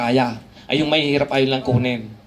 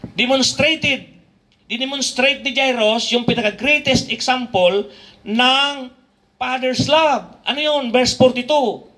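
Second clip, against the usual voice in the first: slow, raised scripture reading; preaching; 6 semitones higher, slower than usual. Acted. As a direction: read the verse slowly with weight.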